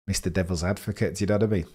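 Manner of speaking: normal talking voice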